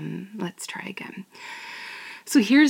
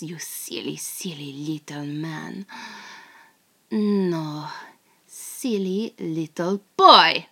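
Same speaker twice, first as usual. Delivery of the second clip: teasing captor. Acted. Captor taunting the tied-up American spy, sultry and mocking. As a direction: toy with the captured spy in a sultry whisper. Performs the script in character.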